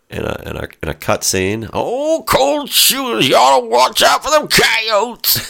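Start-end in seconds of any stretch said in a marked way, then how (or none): 1.7-5.4 put-on high, shouting old prospector voice. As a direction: yell it like a wild old prospector. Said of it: "a character Will Ferrell played".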